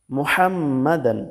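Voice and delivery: মোহাম্মাদান slow, with a strong throaty haa; correct Arabic haa. Shown as a demonstration. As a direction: hold the doubled m of মোহাম্মাদান after a throat haa. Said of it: "তো আমাদের বলতে হবে". (মোহাম্মাদান, আরবি হা দিয়ে ধীরে টেনে)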